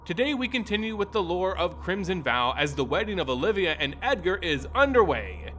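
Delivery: ordinary delivery, nothing marked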